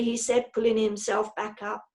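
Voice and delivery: ordinary delivery, nothing marked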